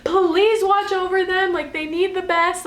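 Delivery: high, slow desperate pleading; screamed prayer. Retold or imitated. Mother in labor screams a slow prayer for her family. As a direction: drag out a frightened plea to God. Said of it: "Like, I'm screaming".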